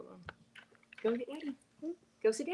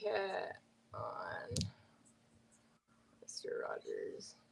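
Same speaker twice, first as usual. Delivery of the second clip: whisper; 12 semitones lower, 18 dB quieter, much slower than usual. Real, not acted. Whispered aside.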